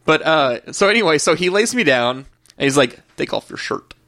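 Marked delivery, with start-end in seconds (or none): 3.2-3.9 hushed voiceless order, retold; mock whisper. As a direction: breathe it out like a creepy doctor's command.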